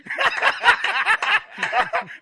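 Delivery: wheezing high burst of laughter; loud high laugh. Real, not acted. Host bursting out laughing at the caller's slip. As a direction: crack up hard.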